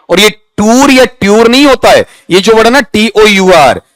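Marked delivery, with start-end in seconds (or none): none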